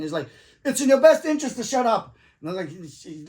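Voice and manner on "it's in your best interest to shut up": higher, louder stern order; stern warning. Retold or imitated. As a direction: bark it as a fed-up cop. Strict cop warns him.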